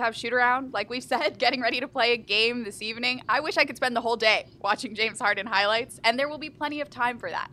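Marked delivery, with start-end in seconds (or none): none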